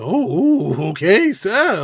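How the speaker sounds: high, loud, sing-song mock clerk. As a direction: squeal it like an impressed clerk. Mocking the bank clerk's reply.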